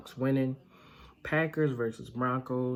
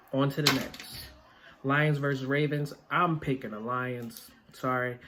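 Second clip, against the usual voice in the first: normal talking voice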